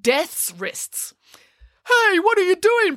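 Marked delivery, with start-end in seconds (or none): 1.9-3.0 startled high yelp of protest; indignant high protest. Acted. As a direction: Death caught off guard, crying out high and loud. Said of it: "protested Death".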